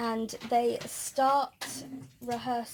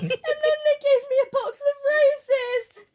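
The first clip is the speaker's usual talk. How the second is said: high, louder, laughing as she speaks; 11 semitones higher, 8 dB louder than usual. Real, not acted. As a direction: gush it out between laughs. Laughing retelling of being given roses.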